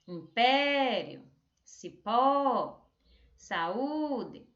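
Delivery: Império, cipó, saúde with PÉ, PÓ, Ú held long; slow list, long open stress on impÉrio, cipÓ, saÚde; calling-out stressed syllables. Shown as a demonstration. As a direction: call each word out, stretching its accented vowel. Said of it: "Tipo chamando".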